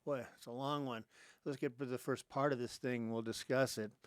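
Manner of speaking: ordinary talk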